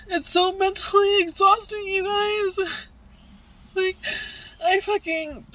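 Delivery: tearful, high voice breaking into sobs; crying. Real, not acted. Mother venting about custody to her viewers, crying. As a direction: cry it out, voice cracking high.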